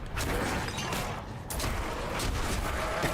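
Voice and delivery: soft, voiceless wheeze of laughter; real giggling fit. Real, not acted. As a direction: laugh helplessly, nearly without sound. Too loopy to go on.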